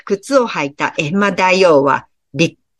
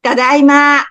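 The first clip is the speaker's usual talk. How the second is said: higher, bright call of coming home; loud cheery "I'm home"; cheerful return; 7 semitones higher, 4 dB louder than usual. Acted. Cook comes home cheerful. (明るく大きな声で帰宅の挨拶)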